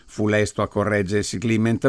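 plain reading voice

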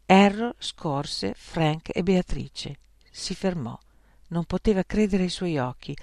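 ordinary delivery, nothing marked

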